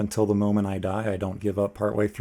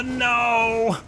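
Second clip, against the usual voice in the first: sudden high cry of dismay, much higher; 13 semitones higher, 4 dB louder than usual. Real, not acted. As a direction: yelp no as the attack lands. Character dies unexpectedly.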